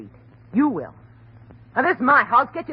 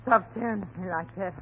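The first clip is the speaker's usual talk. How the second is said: uneasy; 3 semitones lower, 7 dB quieter than usual. Acted. Uneasy wife, first take.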